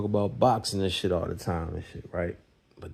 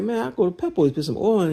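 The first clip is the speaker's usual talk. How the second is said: much higher, dismissive scoff; mock scoffing; 12 semitones higher, 5 dB louder than usual. Telling friend about the oil, high dismissive scoff. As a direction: toss it off like it's obvious.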